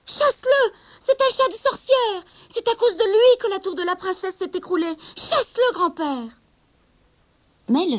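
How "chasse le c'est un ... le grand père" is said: shrill high panicked demand, much higher and louder; frightened girl. Acted. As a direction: servant begging grandfather to chase the cat. Rosabelle effrayée accuse le chat. (jeune fille paniquée, voix très aiguë et forte)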